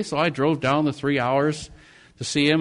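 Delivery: ordinary talk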